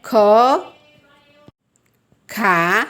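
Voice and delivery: clear, separate syllables ख and खा; teacher reading barakhadi aloud; syllable drill. Shown as a demonstration. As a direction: pronounce ख then lengthen to खा. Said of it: "जैसे".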